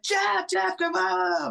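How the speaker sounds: high-pitched mock calling out; imitating loud sister. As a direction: mimic a sister hollering to wake someone, without real volume. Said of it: "my sister's very loud".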